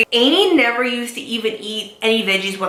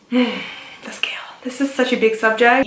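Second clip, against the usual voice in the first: hushed. Real, not acted. Vlogger introducing the scale as a big subject, hushed.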